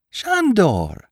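Shandor screamed high and bright; screaming the name. A narrator's reading. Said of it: "screamed the girl".

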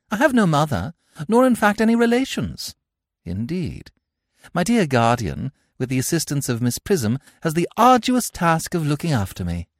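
put-on a touch of girlish politeness; lightly voiced young girl. Cecily voiced in read play.

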